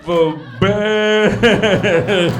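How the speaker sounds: loud, hugely stretched slow-motion yell. Acted. Owner hearing the cat retch, slow-motion yell. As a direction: drag every word out like slowed-down film. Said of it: "the whole world goes into slow motion".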